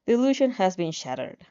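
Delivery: ordinary delivery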